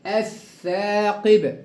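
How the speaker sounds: الثاقب with held double th and long aa; lam merged into th. Shown as a demonstration. Teacher drills the second word, lam merged into th. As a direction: recite الثاقب slowly, lam silent. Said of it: "أضغمت اللام في الثاء المشددة".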